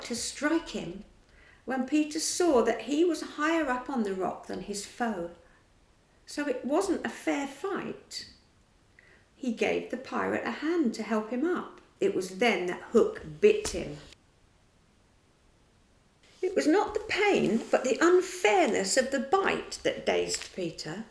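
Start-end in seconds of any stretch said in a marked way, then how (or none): none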